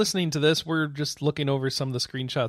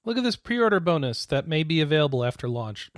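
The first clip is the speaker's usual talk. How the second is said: ordinary talk, nothing marked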